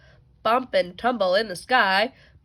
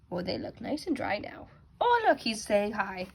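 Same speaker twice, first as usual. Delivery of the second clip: plain reading voice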